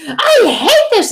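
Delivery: high, loud, slow frustrated cry; mock a little kid raging at her drawing; child's tantrum. Imitating daughter yelling at her ruined drawing, tantrum cry. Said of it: "my daughter does this all the time".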